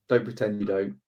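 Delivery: ordinary talk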